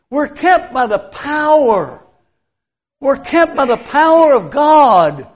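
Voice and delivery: slow, weighty stress on "kept" and "power"; a deliberate, measured pulpit declaration; emphatic. Real, not acted. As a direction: lean hard on every word. Preacher driving the point home.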